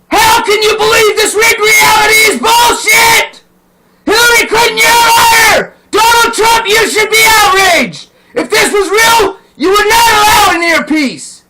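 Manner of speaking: high, loud, voice cracking with rage; real furious tearful yelling; furious crying shout. Real, not acted. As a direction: scream it at them, near tears. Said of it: "but I'm pissed off".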